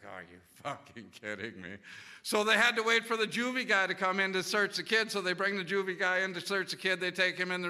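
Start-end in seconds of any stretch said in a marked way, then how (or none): 0.0-1.8 low, soft, stunned murmur; quietly floored by the felony threat; stunned disbelief. Retold or imitated. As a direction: say it half to yourself in disbelief. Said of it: "And I'm just like".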